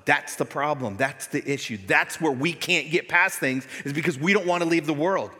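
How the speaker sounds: loud, driving sermon voice; fiery preaching. Acted. Pastor urging the church to leave the world, loud preaching. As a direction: preach it hard to the congregation.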